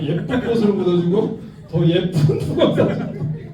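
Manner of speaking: slow, tender 예쁜 붕어 사줄게; gentle promise. Shown as a demonstration. Lecturer replaying the mother's promise to her son, slow and tender. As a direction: promise it sweetly like to a crying boy. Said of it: "예쁜이 많이 나오시네요".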